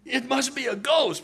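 high, loud, drawn-out it's a ghost; terrified cry. Retold or imitated. Preacher voicing disciples who think Jesus is a ghost, high terrified cry. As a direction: cry it out as if you've seen a ghost. Notice what they say.

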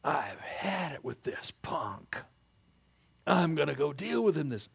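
a touch of mock fury; mock angry David. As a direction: build to a hammed-up rage. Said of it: "Does David say".

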